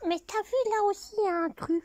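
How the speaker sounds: high squeaky puppet voice for the teddy; teddy bear voice. Pretend. Grandmother voicing the teddy bear for her granddaughter, high and squeaky. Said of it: "et je parle comme ça avec lui".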